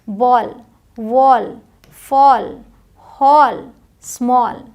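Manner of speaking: rounded "aw" in बॉल, वॉल, फॉल, हॉल, स्मॉल; correct pronunciation. Shown as a demonstration. Teacher drills learners, correct "aw" in बॉल, वॉल, फॉल, हॉल, स्मॉल. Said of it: "ओ करके बोलेंगे".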